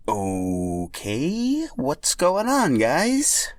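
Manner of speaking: put-on high, slow Italian-accented greeting; Mario voice. Mario greeting the pink Yoshi, Italian accent. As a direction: stretch it out like Mario.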